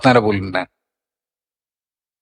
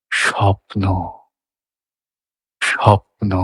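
dreamy feeling; 4 semitones lower, much slower than usual. Shown as a demonstration. Teacher voicing the feeling of a dream to students. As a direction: breathe স্বপ্ন like a dream. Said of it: "দেখুন".

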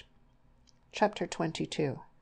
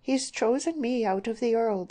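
higher-pitched, proud declaration; 7 semitones higher, 4 dB louder than usual. A narrator's reading. Daughter defending her chosen man, proud. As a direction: declare it with pride. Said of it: "said Deborah with pride".

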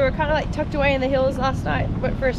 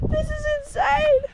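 excited. Real, not acted. Amazed by snow.

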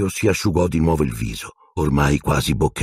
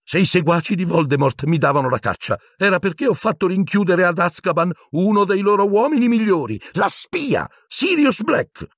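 squeaking. A narrator's reading. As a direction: squeal your innocence in panic. (Pettigrew squittisce acuto e forte)

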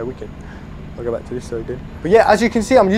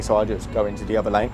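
usual speaking voice

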